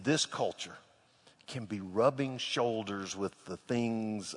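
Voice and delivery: hushed sermon reflection; quiet preaching. Acted. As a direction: let the lesson sink in gently. Turns to quiet sermon point.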